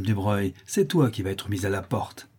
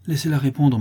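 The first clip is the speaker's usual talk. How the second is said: raised, firm insistence; insistent interruption; 6 semitones higher, 5 dB louder than usual. A narrator's reading. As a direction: cut in and demand an answer. Widow cuts in sharply. (interruption insistante)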